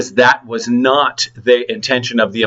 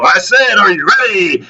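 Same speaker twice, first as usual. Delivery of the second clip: higher, louder hammed-up boss yell; mock yell; 7 semitones higher, 3 dB louder than usual. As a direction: pump the bouncer up like a coach shouting. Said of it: "And I go".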